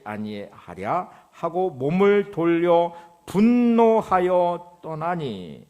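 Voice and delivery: loud, declamatory Bible reading; scripture reading. Acted. Preacher reads Naaman's angry reply to the congregation, loud scripture reading. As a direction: proclaim the passage, drawing out the closing words.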